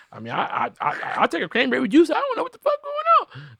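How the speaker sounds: very high, squeaky, laughing bewilderment; shell-shocked order. Pretend. Guy answering the flight attendant, very high bewildered voice. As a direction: order juice in total confusion.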